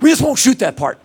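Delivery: high, loud director quote; imitated director. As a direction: imitate a director waving off a problem loudly. Quotes director brushing off a problem.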